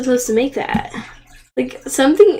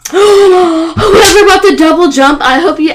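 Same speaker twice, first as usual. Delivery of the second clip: angry shout; 5 semitones higher, 8 dB louder than usual. Real, not acted. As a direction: shout it out in real frustration. Rage at rival stealing win.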